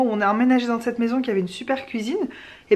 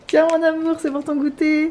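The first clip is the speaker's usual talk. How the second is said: higher, sing-song doting voice; silly sweet voice; 6 semitones higher than usual. Pretend. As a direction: coo like a doting parent. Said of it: "on parle comme des abrutis".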